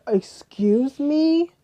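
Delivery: high, slow, drawn-out disbelief; retold high, slow "excuse me" in disbelief; incredulous. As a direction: stretch it out, scandalised. Retold outrage at bail request.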